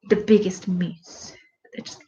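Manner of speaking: hushed whisper. Acted. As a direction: breathe it out in awe. Whispered emphasis on size.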